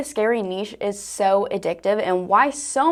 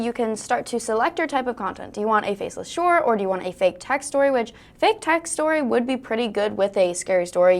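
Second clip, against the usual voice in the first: normal talking voice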